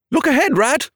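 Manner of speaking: high loud alarmed shout; sudden warning cry. Acted. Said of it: "cried the mole suddenly".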